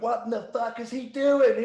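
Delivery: high loud outraged yell, slowed; furious. Retold or imitated. Booker Jeff yelling backstage at a botched segment, furious high yell. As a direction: imitate a furious booker losing it. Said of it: "Jeff just turned around and went".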